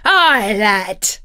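loud, hearty pirate voice; booming pirate reply; pirate captain. A narrator's reading. Pirate captain answers the boy.

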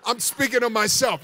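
ordinary delivery

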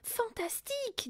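high, drawn-out delight; delighted wonder. A narrator's reading. Mathilda amazed by the talking parrot, delighted. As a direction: marvel at it, slowly and high.

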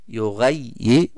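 يغير read slowly with the doubled letter; slow stressed doubling. Shown as a demonstration. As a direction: lean on the shadda slowly. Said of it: "شدة مع الكسر". (نطق بطيء مع تشديد)

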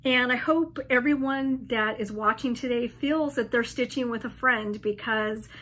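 normal talking voice

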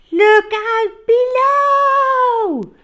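very high, slow, loud warning cry. Acted. Dinosaur diving into the pool, loud slow cry. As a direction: stretch out the warning. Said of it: "cried the Super Swooper".

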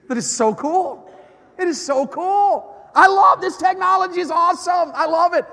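high, loud, gushing delight; gushing excitement. Real, not acted. Preacher gushing to teens about phones, high and loud.

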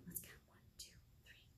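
whispered counting. Real, not acted. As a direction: count it in a hush. Counting softly.

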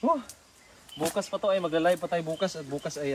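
ordinary talk

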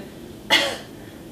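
a short unvoiced cough; one sharp cough to feel the belly pull in; single cough from the belly; demo cough. Shown as a demonstration. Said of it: "let's just begin with a cough".

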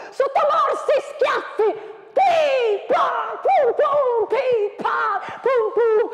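shouted gunfire sounds. Acted. Voicing the gunfire of the battle poem, shouted. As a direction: spit out the shots.

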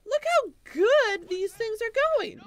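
high, loud mock-cheerful squeak; sarcastic falsetto. Player pretending the losing battle goes well, mock falsetto cheer. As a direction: chirp fake joy while losing.

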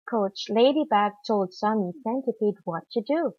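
plain reading voice

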